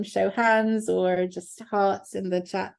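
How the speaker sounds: normal talking voice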